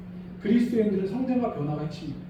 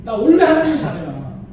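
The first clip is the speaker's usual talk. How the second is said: confident declaration; 2 semitones higher, 7 dB louder than usual. Shown as a demonstration. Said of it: "이렇게 말하든지 차라리".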